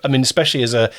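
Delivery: ordinary talk, nothing marked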